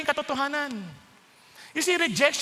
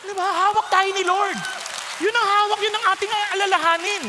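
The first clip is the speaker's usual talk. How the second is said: loud, raised preaching; preaching at full force; 6 semitones higher, 6 dB louder than usual. Acted. Preacher assuring the church, loud preaching. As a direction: proclaim it to the whole church.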